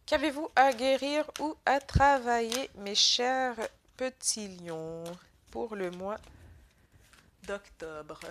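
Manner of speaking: talking through a stuffy nose; blocked nose, stuffy voice. Real, not acted. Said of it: "je parle avec le nez bouché".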